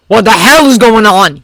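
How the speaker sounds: loud, rough outburst; exclaimed. Acted. Bakugo demanding answers from the room, loudly. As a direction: blurt it out, confused and angry. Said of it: "he exclaimed".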